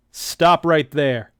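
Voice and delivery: loud slow command, not yet in the right voice; a rough first take on the tough guy; first try at the sergeant. Shown as a demonstration. Reader trying out D J's voice, loud slow command. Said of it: "let me get that voice right".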